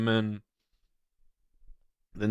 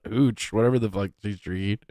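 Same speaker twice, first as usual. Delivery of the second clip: ordinary talk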